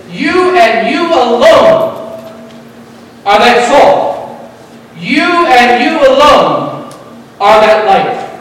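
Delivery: preaching the emphatic you alone, slow and strong. Acted. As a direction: hammer each you, drawn out and loud. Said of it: "it's in the emphatic mood".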